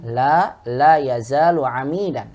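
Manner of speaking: teacher demonstrating the line for the student to repeat; model reading. Shown as a demonstration. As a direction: read it slowly and firmly, pausing after La.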